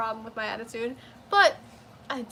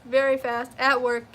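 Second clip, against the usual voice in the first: ordinary talk, nothing marked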